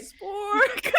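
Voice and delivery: high, laughing wail of the name; mock wail. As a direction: wail it out, hammed-up and half laughing. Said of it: "wail at him".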